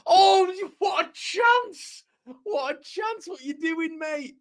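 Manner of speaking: very high-pitched wail, dropping in volume; agonised high cry, stretched out and fading; disbelief at missed chance. Real, not acted. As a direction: squeal in disbelief, slowly losing power. Reacting live to a missed chance.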